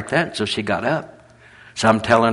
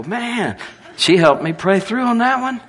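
excited; 12 semitones higher, 5 dB louder than usual. Retold or imitated. Preacher's excited retold remark.

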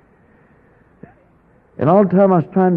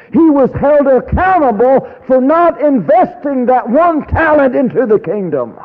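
preaching voice; 11 semitones higher than usual. Acted. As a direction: build into a sermon. Preaching parable.

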